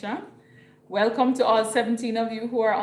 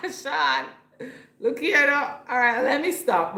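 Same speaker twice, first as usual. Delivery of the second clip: real high, loud burst of laughter; laughing; 7 semitones higher, 7 dB louder than usual. Real, not acted. Streamer reacting to a viewer called Sean, laughing out loud. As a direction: talk through a big laugh.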